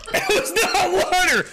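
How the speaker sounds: choked-up, high-pitched disbelief; strained after coughing. Real, not acted. Streamer reacting to chat's drink, croaking high.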